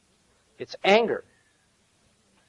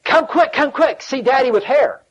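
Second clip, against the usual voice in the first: put-on high, eager child's voice calling out; little son calling excitedly from the living room; excited little boy; 7 semitones higher than usual. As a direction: imitate a four-year-old shouting for everyone to come. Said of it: "I heard him say".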